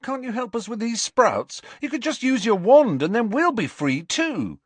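high-pitched, insistent plea; urgent, high appeal; pleading. A narrator's reading. Ron pleads with twins for help.

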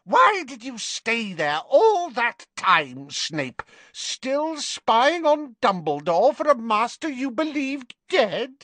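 high, slow accusing witch; suspicious, cutting witch; Bellatrix voice. A narrator's reading.

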